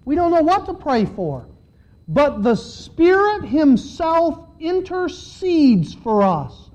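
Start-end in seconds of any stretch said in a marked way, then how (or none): 2.1-6.7 slow, drawn-out groaning delivery. Acted. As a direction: stretch each word like a groan. Preacher stretches out the groaning line.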